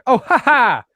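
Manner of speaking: high, loud mock laugh in an American accent; laughing American woman. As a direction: imitate an American lady's big laugh. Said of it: "She had an American accent".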